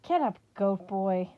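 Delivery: irritated snap at a kneeling satyr; snapping. Acted. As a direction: bark the order down at him. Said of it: "Talia snapped".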